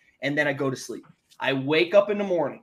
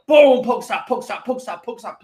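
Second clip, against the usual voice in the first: loud, high burst of glee; excited boom; 5 semitones higher, 7 dB louder than usual. Retold or imitated. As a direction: blurt it like a jackpot hit. Gleefully catching Pokemon.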